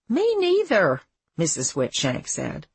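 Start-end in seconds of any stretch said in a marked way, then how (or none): 0.1-1.0 high, lilting agreement; older mother figure. A narrator's reading. Missus Whitshank agrees with Abby, high and lilting. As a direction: voice a chatty mother, pitched well up.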